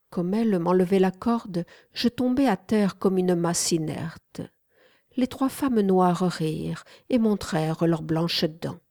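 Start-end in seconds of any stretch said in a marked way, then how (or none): none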